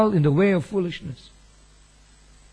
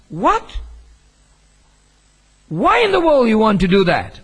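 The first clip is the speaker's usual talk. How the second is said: higher and louder, stunned; shocked disbelief; 4 semitones higher, 7 dB louder than usual. Retold or imitated. Pastor reacting to a young man's shocking confession, stunned and loud. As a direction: react as if you can't believe your ears. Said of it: "My natural reaction to it was".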